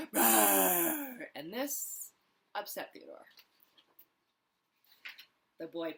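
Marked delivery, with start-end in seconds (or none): 0.1-1.3 roar. Acted. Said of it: "He roared again".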